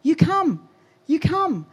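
high, loud, drawn-out calling; slow acted shout to come; imitated summoning call. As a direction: call someone over from far off. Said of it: "they start to summon me".

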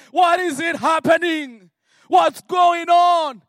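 loud, high, slow and drawn-out complaint. Retold or imitated. A frustrated believer loudly complaining to God. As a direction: stretch out the question in frustration.

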